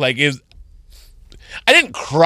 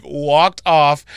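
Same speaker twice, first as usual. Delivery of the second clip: ordinary talk, nothing marked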